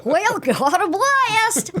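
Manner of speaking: high cartoon boy with a slow country twang, laughing; Jimmy Neutron country singer. Asked for Jimmy Neutron as a country singer, slow high twangy drawl. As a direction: drag the catchphrase out like a country crooner. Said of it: "What would, uh, Jimmy Neutron as a country singer sound like?".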